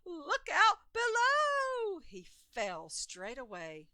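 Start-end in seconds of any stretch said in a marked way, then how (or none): 0.0-2.0 high, slow, loud warning yell; alarmed shout. A narrator's reading. Ollie falling off his stilts, yelling a high warning. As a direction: cry out while toppling over.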